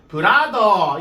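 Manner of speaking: 브라더 loud and flat as plain Korean syllables; mock Konglish 브라더. Shown as a demonstration. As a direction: blurt 브라더 with no English r or th. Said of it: "이렇게 하면".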